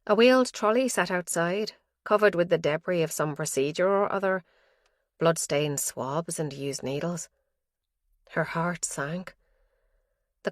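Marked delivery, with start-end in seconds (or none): none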